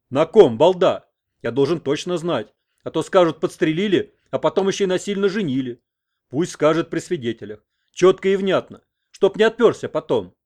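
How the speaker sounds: loud insistence. A narrator's reading. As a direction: bark it for witnesses. Headman demands clear proposal. (громкое требование при свидетелях)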